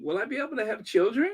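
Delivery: high, fast, mock-worried question; hammed-up fretting, high and fast; mock panic. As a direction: fake alarm about her hearing. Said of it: "I'm just like".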